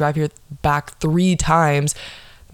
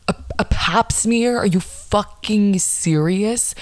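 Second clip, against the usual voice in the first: incredulous outrage; 5 semitones higher, slower than usual. Acted. Disbelief at first penetration being exam.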